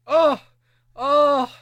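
high and loud through laughter; laughing. Real, not acted. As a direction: cry out between laughs. Cracking up at the list.

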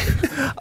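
short high giggle. Real, not acted. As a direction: burst into a squeaky giggle. Laughs at own voice.

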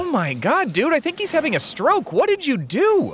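high-pitched, alarmed outburst; panicked alarm. Acted. Friend thinks the heckled man is having a stroke, panicked. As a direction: jump way up in pitch with horrified disbelief.

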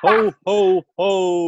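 loud, high ho ho ho; lightly voiced Santa; Santa's jolly ho ho ho; mock Santa ho ho ho. Santa laugh.

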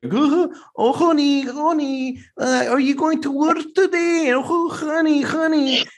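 very high mock-accent squeal of "honey"; Sofia Vergara. Husband mocking the TV star for playing up her accent, very high exaggerated accent. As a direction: ham up the foreign accent way up high. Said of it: "She plays it up, and she, she's like".

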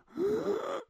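high, strained gasps with gaps between; imitated struggle to breathe. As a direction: act out fighting for air in your sleep. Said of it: "I'm trying to breathe".

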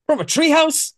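high, loud, mock-outraged disbelief; imitating a baffled crook, pitch leaping up; incredulous outburst. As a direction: blurt it out in hammed-up disbelief. Retold villain's incredulous outburst.

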